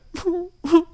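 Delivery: high wailing cry sound; a little rabbit boo-hoo, high; bunny crying. Acted. Sad bunny starts crying as they ignore him, high wail. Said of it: "started crying".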